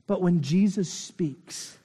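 ordinary delivery

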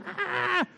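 a short high mock shriek of fright; mock terrified scream. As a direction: shriek like a scared disciple. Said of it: "They're, like, screaming".

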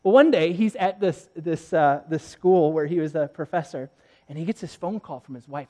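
normal talking voice

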